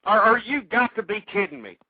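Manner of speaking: ordinary talk, nothing marked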